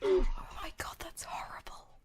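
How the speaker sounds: gasping whisper. Pretend. Gasping reaction to the book.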